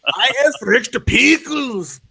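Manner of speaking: slow, high-pitched mock outrage; Matt Bell. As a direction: badly imitate an angry customer, drawn out. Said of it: "I can't do a Matt Bell voice".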